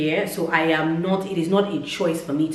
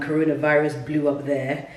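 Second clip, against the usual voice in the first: ordinary talk, nothing marked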